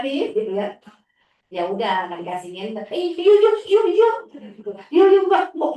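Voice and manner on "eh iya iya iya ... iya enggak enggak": put-on much higher, louder pleading kid voice; whining child. Teacher mimicking the boy pleading with his father, high loud childish squeal. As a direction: squeal yes-yes-no like a tantrum child.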